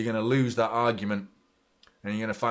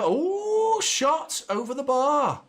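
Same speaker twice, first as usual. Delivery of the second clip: much higher, louder, slow anguished cry; anguished groan; 6 dB louder, much slower than usual. Real, not acted. Fan watching Silva's shot fly over, anguished. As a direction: groan at the miss, dragging the words. Chance missed live.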